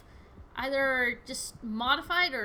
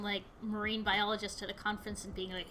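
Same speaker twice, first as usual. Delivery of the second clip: ordinary talk, nothing marked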